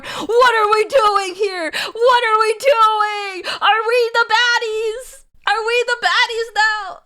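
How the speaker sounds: high, loud wail, drawn out; mock despair. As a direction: cry out in hammed-up anguish. Mock panic over own antics.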